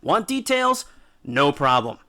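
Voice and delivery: ordinary talk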